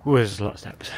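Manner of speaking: normal talking voice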